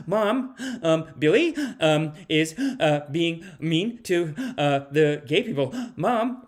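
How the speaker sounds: tattle to mom, gulping air between phrases; whining little kid. Acted. Little kid tells mom about Billy, breathless and halting. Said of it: "a little kid who's like".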